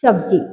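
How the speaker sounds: सब्जी said with sh, like shabzi; mispronounced sabzi. Shown as a demonstration. Teacher mimicking a child's shabzi. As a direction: replace the s of सब्जी with sh. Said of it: "सब्जी को".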